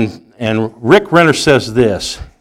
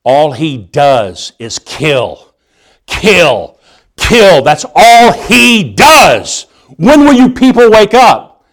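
mad, building to shouting; 2 semitones higher, slower than usual. Acted. Movie witness trying to convince police about the cyborg, increasingly mad. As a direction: build from restrained frustration into an exasperated yell. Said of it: "he just gets mad".

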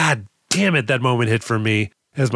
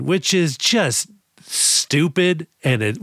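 ordinary talk

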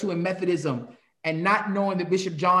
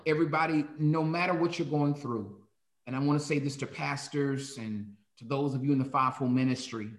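pastoral closing blessing; 4 semitones lower, 5 dB quieter than usual. Acted.

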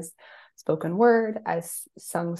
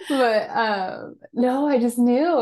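near tears; 5 semitones higher, 5 dB louder, much slower than usual. Real, not acted. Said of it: "makes me wanna cry".

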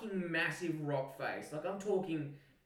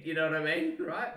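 ordinary talk

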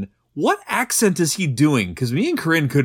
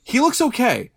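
much higher mock-agreeing echo; sarcastic echo; 8 semitones higher than usual. Real, not acted. Echoing cohost's praise of the zombie, sarcastic. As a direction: parrot it back with sarcasm.

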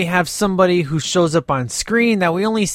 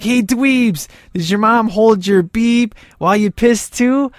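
higher, mocking jeer; taunting punks; 6 semitones higher than usual. Punks in the car taunt Dean's grandma, jeering. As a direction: sneer it at the nerds. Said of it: "and they're like".

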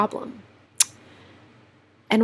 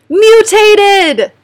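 sudden high, loud shout of the word; yelling; 11 semitones higher, 11 dB louder than usual. Real, not acted. Finally finding the word she searched for, a triumphant yell. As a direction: burst out with it in triumph. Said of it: "Sorry for yelling".

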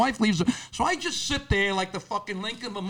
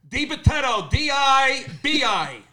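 high, drawn-out letters for someone who can't hear; raised-voice spelling; 6 semitones higher, slower than usual. Acted. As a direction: call the name out, stretching each letter. Repeats name to unhearing pharmacist.

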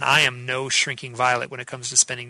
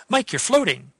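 put-on light, higher German accent of a wife; imitating her cute German accent, pitched up; 8 semitones higher than usual. Wife waking him in the tent, cute German accent. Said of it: "with her German accent ... her funny voice".

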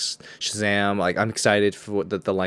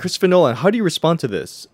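higher, louder press-pack question; mock reporter; 8 semitones higher, 6 dB louder, faster than usual. Co-host playing a reporter grilling a director, raised urgent voice. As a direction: call out like a reporter demanding an answer.

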